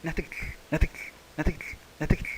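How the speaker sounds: quick repeated Ña, Tecetl; Ña, Tecetl run together at speed; fast. Shown as a demonstration. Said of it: "Así, rápido".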